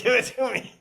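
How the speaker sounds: high, loud acted demand; mock-insistent quote amid laughter; retold demand.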